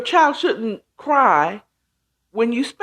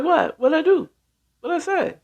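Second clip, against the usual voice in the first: bewildered; 6 semitones higher than usual. Retold or imitated. Acted confused reaction, higher pitch.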